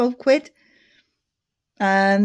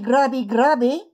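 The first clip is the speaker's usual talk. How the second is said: ordinary talk, nothing marked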